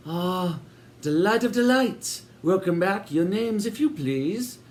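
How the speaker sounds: high, sugary welcoming landlady voice; sinister hostess. A narrator's reading. Landlady welcoming Sid and Eric, high and sweet. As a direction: greet them grandly, a touch too sweet.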